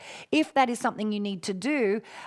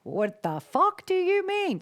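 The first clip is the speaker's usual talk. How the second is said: incredulous, slow and much higher; imitating baffled newcomer; 10 semitones higher, slower than usual. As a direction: play a stunned newcomer demanding what she means. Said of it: "you're like".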